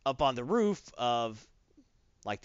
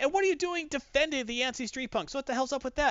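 accusing; 12 semitones higher than usual. Retold or imitated. Johnny accusing.

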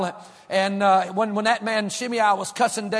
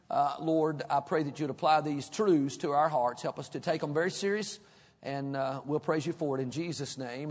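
quiet, low prayer voice; soft prayer; 5 semitones lower, 7 dB quieter than usual. Acted. Pastor praying softly before preaching. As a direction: pray humbly and softly to God.

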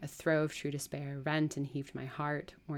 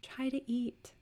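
high, tender coaxing; gentle; 9 semitones higher, slower than usual. Acted. Sisters urging the starving woman to eat, gently. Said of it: "repeated Mary gently".

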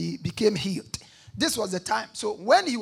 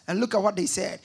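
ordinary delivery

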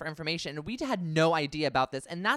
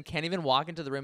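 ordinary delivery

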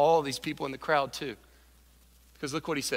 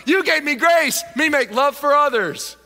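put-on high, loud kid voice; childlike Zacchaeus; 10 semitones higher, 9 dB louder than usual. Zacchaeus thanks Jesus like a small child, high and loud. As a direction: gush like a child to his father. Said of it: "That's what Zacchaeus is doing".

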